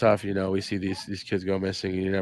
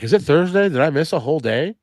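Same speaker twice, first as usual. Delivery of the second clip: panicked, higher, louder viewer voice; confused viewer; 7 semitones higher, 5 dB louder than usual. Retold or imitated. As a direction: play a flustered viewer checking calendars.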